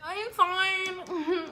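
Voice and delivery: high, slow, crying insistence; mock crying, high and slow; tearful. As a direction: fight back tears while saying it. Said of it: "like, in tears".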